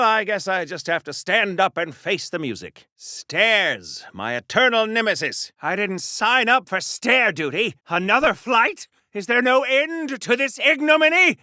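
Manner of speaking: usual speaking voice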